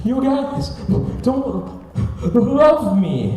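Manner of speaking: high, halting words between hammed-up sobs; mock sobbing. Crying kid to his dad at home, mock sobbing. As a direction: imitate a kid too tearful to get words out.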